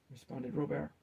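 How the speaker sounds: ordinary delivery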